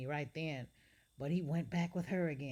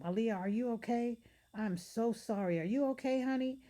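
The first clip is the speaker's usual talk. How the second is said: higher, worried voice of a teacher; a hint of an anxious teacher; concerned teacher; 8 semitones higher, 4 dB louder than usual. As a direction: fuss over her gently. Teacher checks on hurt girl.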